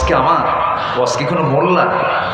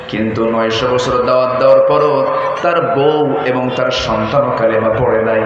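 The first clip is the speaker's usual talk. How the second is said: ordinary delivery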